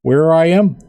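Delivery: easygoing orc chatting, relieved; friendly orc. Acted. Orc tells Royale with Cheese he's fine, lightly voiced.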